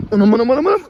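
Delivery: high, loud yelp of alarm; alarmed outcry. Real, not acted. Playful puppies swarm him, he cries out. (telaşlı, tiz bir feryat)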